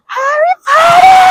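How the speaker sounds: very high, loud fan shriek; long high-pitched cheer; excited fan squeal. Real, not acted. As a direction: squeal the name out in delight. Fan announcing the studio tour.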